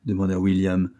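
plain reading voice

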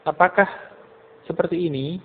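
"Apakah seperti ini" lifted in pitch, rising at the end; slightly rising question. Shown as a demonstration. Teacher shows how a person asking sounds, slightly rising question. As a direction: raise "ini" gently, asking without shouting. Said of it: "dari datar agak meninggi sedikit".

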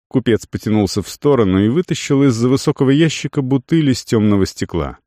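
ordinary delivery, nothing marked

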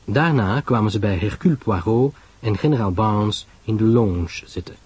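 usual speaking voice

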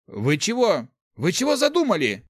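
worried question climbing high; agitated. A narrator's reading. Thief asking what his teachers are plotting, agitated. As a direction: ask in rising alarm. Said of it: "взволнованно спросил воришка".

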